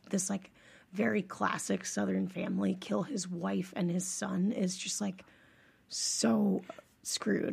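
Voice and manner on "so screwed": hushed whisper of disgust. Real, not acted. Reacting to the family murder case in a whisper. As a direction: drop to a breathy whisper.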